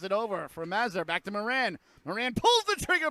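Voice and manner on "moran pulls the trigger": excited shot call. Real, not acted. Broadcaster as the power play shoots, excited shot call. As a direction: pitch and volume jump on the shot.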